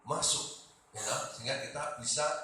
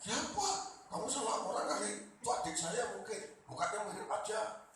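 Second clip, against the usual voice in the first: high-pitched evasive excuse; Peter denying; 11 semitones higher than usual. Acted. Peter dodging a questioner, high-pitched denial. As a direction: deny it in a high voice. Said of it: "waktu dikorek tentang imannya".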